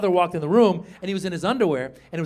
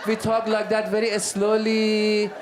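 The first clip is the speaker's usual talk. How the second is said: put-on very slow Iranian accent; exaggerated, languid Iranian accent; dragged-out Iranian accent; 3 semitones higher, slower than usual. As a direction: drag out every word with an Iranian accent. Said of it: "Every word we drag out".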